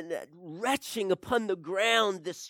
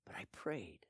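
quiet; 9 semitones lower, 16 dB quieter than usual. Acted.

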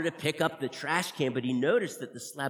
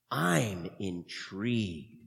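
grandly intrigued; 8 semitones lower, much slower than usual. Acted. Dramatic slow big word.

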